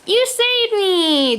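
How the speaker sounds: high, drawn-out joyful cry; storybook little girl's grateful cry. A narrator's reading. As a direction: exclaim slowly in a lifted girl's voice. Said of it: "the girl cries out".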